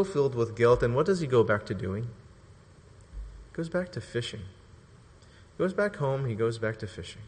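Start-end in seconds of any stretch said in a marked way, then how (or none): 2.0-6.2 very slow, near-whispered; hushed reveal. Real, not acted. Preacher revealing Peter's retreat in shame, hushed. 6.2-7.2 soft, low-pitched close; subdued repeat. Real, not acted. Preacher repeating Peter's retreat, subdued.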